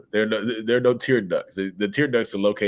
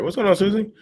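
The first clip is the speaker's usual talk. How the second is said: much higher, louder hello to a viewer; excited greeting; 6 dB louder than usual. Real, not acted. Greeting Susie in the live chat with a loud, high welcome. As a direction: call out a bright welcome.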